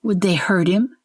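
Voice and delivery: plain reading voice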